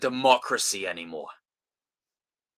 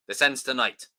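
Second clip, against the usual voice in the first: ordinary talk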